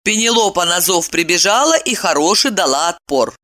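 plain reading voice

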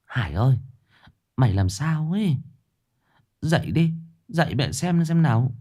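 high, anxious mother waking her son; mother calling her son, frightened and pleading; alarmed mother. A narrator's reading. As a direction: shake him awake in a panic. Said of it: "thì hốt hoảng".

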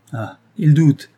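anguished outburst; exclaiming. A narrator's reading. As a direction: cry out at being doubted. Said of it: "s'écria Norbert".

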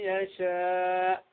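من يشاء with the nun merged into the ya; slow nasal merge of من يشاء; merged ghunna. Shown as a demonstration. Teacher demonstrating idgham into ya. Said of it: "الياء".

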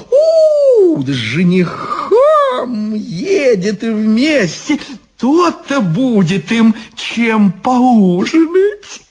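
high, stretched witchy whoop; overjoyed. A narrator's reading. Baba Yaga spots the fiancé too, gleeful. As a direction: whoop and relish the news. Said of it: "обрадовалась Баба Яга".

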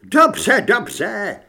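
high, loud yelp of surrender; panicked shriek. A narrator's reading. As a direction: give in with a high squeal. (vyděšené zaječení)

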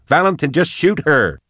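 high, exasperated urging; urgent demand. A narrator's reading. Caged Max urges the gunman to shoot, high urgent demand.

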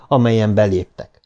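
plain reading voice

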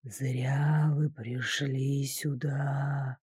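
low, slow, hissing ghost voice; ghost whisper. A narrator's reading. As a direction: hiss it like a hateful spirit. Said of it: "прозвучало опять".

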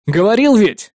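high, loud challenge; angry demand. A narrator's reading. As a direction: demand that they confirm it. Angry demand to confirm the order.

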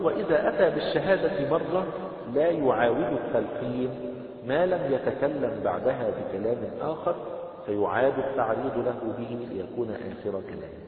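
slow, loud, measured recitation of the book; formal text reading. Acted. Teacher reading the book text aloud to the class, slow formal recitation.

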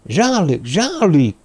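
rising, high-pitched calls; urgent calling. Acted. François runs to collapsed Jean-Luc, urgent calling.

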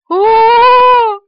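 long high loud "oh" scream; sustained wail of horror. A narrator's reading. Woman learns a cockroach is on her, screaming. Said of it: "закричала Полина".

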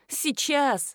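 usual speaking voice

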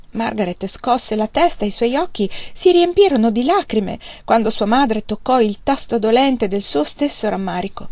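usual speaking voice

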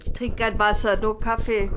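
loud, flat refusal; stubborn shout. Acted. Mother refusing the water, stubborn loud. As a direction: bark it back defiantly.

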